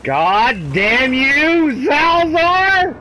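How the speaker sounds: loud, slow, high furious shout; angry curse. Real, not acted. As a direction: draw out the curse in rage. Cursing enemy player.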